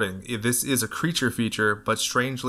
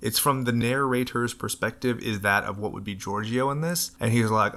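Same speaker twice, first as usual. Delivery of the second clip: ordinary delivery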